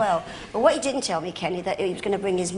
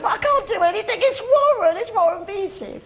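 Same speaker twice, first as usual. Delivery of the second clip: much higher, louder, laughing protest; giddy disbelief; 11 semitones higher, 4 dB louder than usual. Retold or imitated. An actress gushing to her friend Victor about the star. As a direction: squeal that it's Warren, slowing on his name. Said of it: "I said".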